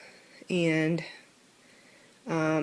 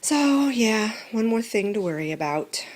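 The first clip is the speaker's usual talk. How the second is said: ordinary talk, nothing marked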